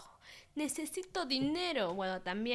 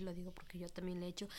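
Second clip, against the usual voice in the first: quick unvoiced whisper; whispered aside; 4 semitones lower, 8 dB quieter, much faster than usual. Real, not acted. Whispering a confession to the viewers.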